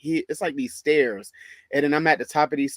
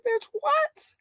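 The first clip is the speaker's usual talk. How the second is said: very high-pitched incredulous squeak; shocked disbelief. Retold or imitated. Worker reacting to line leader's grab, shocked. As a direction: shoot the question way up in mock shock. Acted shock at being grabbed.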